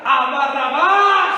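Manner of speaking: high, loud, slow drawn-out cry; the preacher re-enacting the crowd choosing Barabbas; crowd shout. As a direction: bellow the name like a mob. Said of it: "cuando la gente grita".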